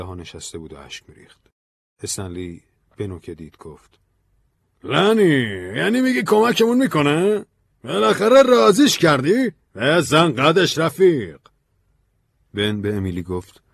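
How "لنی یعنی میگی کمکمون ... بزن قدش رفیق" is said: loud, high-pitched excited outburst; thrilled, high and loud. Acted. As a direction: burst out in delighted disbelief. Stanley greets good news from Ben.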